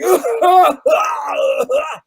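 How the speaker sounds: put-on old people crying out. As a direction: imitate frightened old ladies crying out. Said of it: "people in the audience who are old going".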